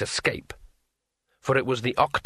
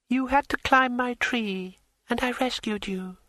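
smooth dryad voice; 12 semitones higher than usual. A narrator's reading. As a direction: glide through it smoothly. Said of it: "said the dryad smoothly".

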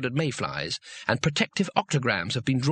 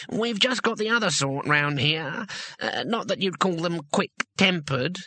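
higher gnome voice, slowing at the end; careful gnome; 4 semitones higher than usual. A narrator's reading. Gnome warning about the local elves, careful. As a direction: explain cautiously in a gnome's voice.

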